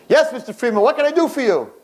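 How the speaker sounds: high-pitched, eager nurse voice; acted nurse rushing in; hurried nurse. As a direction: answer the bell in a high singing call. Nurse rushes in answering the bell.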